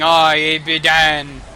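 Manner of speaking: loud, drawn-out mock Scottish; exaggerated Scottish accent, slow and loud; mock Scottish dialect. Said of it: "two thousand year old Scottish dialect".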